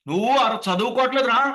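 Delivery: loud, high demo yell; full open-voiced scolding. Shown as a demonstration. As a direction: let the scolding out fully, throat unheld. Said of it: "బయటకు పూర్తిగా ఇవ్వాలి". (గొంతు విప్పి గట్టిగా తిట్టడం)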